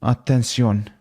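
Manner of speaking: ordinary talk, nothing marked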